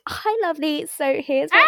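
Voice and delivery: chirpy seller voice; brand persona voice. Retold or imitated. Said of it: "I fully become, like, a different per".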